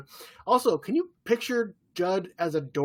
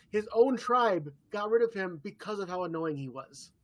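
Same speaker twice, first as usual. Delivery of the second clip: normal talking voice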